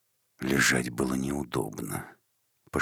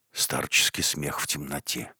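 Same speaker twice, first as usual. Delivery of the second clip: ordinary delivery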